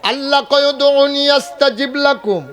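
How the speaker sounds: high, loud sermon cry; preacher voicing God's promise to the faithful, loud; fiery preaching. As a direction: preach it out to the crowd. Preaching at full force.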